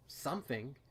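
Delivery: ordinary delivery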